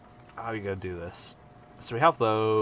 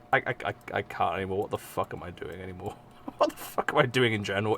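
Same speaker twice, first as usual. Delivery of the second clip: fast mutter; 4 semitones lower, 7 dB quieter, faster than usual. Real, not acted. Rapid mumbled self-doubt.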